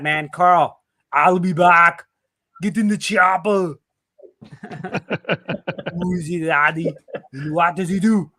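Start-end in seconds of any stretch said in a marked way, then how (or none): 1.1-3.8 famous one-liners in a mock Austrian accent; bad German accent. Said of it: "lots of really bad German accents". 5.9-8.3 mock Austrian action-hero accent; hammed-up German-accented movie line; bad German accent. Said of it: "lots of really bad German accents".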